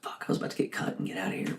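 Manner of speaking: a fast whispered curse to yourself; whispered inner thought. Retold or imitated. Waiter dreading a late table, whispering fast. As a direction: whisper it under your breath, quick. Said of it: "I'm like".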